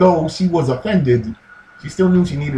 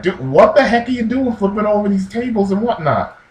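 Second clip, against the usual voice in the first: higher-pitched mock indignation; retold outraged challenge, pitched up; indignant Pharisee; 8 semitones higher than usual. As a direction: demand an explanation, half in jest. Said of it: "getting into a heated exchange".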